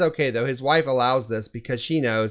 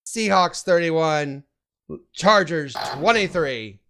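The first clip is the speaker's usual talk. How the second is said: slow dramatic reveal; slower than usual. Acted. Predicting final score dramatically.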